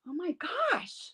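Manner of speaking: high, hushed retold excitement; excited exclamation. Healer recalling her first animal message, excited. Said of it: "I was just so excited".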